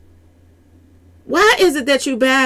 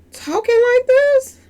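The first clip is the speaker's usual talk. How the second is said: incredulous; 13 semitones higher, slower than usual. Real, not acted. Incredulous about man in clip.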